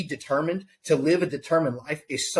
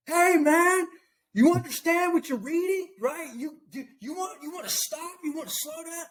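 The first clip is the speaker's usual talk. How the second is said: calling after the chariot; 14 semitones higher than usual. Retold or imitated. Philip running after the chariot, calling up high, fading. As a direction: shout up to the rider, then run out of breath.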